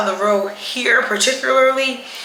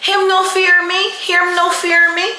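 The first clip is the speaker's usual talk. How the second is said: imitating crying Sarah; 7 semitones higher than usual. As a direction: mimic a crying local woman's plea, pitched up. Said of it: "She's saying".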